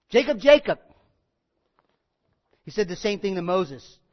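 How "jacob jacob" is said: louder calling of the name; loud call of the name twice. Acted. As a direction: call him like from a distance.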